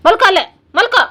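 high, loud command; loud telling-off to a pet; scolding the dog. Real, not acted. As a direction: shout the dog down to its spot. Orders the dog to lie down.

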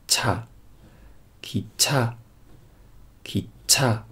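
'cha，ki cha' stretched out syllable by syllable, very slowly; very slow demo. Shown as a demonstration. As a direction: spell out 'ki cha' one drawn-out sound at a time. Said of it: "这样读".

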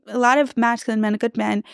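ordinary talk

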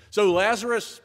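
rally the church with rising fire; fervent preaching. Acted. Pastor urges the congregation toward the marginalized, fervent.

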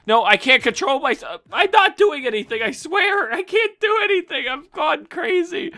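high, loud, rushed protest; frantic panic. Real, not acted. Gamer panicking as his character fights. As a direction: protest that you can't stop yourself.

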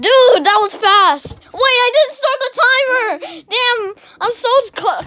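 high, loud burst of surprise; flustered exclamation. Real, not acted. Player realizing the timer never started, flustered. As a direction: blurt it out, caught off guard.

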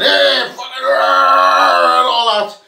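bad Scottish accent, roared battle cry; Mel Gibson. Mocking the opposing team charging like Braveheart, bad Scottish accent yell. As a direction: shout it in a hammed-up Scottish accent. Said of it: "Bit like Mel Gibson, you know, that shit Scottish accent".

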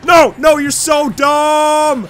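loud, high exasperated shout; shouted, drawn-out exasperation; frantic yelling. Real, not acted. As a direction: yell at the game in a panic. Yelling at game in panic.

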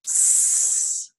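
long hissing sss like a snake; imitated snake hiss. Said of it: "the only thing I've heard them say is".

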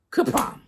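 kapom crash. Acted. Rock knocks Anansi down.

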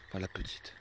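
ordinary talk

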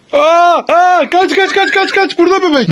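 high mock-frightened cry; play panic. Parent urges child to flee the baby, play panic. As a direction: yelp the warning in pretend fright.

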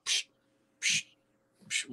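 quick "psh" bursts for windows popping; imitated breaking glass. Firefighter describes windows going out, imitated bursts. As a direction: mimic glass blowing out, one burst at a time.

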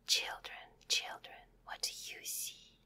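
very soft, breathy whisper; fading storybook whisper. Acted. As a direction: hush it like a secret to the kids. Whispered repeat of the refrain.

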